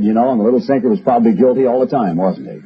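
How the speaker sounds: normal talking voice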